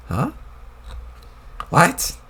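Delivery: ordinary delivery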